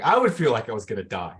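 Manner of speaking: ordinary delivery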